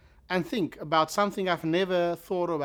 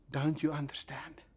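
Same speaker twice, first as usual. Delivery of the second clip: whispered; 3 semitones lower, 9 dB quieter than usual. Shown as a demonstration. Jesus asking in a quiet time, "Don't you understand" whispered. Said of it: "in times when he is quiet".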